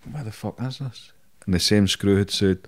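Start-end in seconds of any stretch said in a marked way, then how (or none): 0.1-1.1 much softer, retold private thought; baffled aside. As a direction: say it under your breath, baffled.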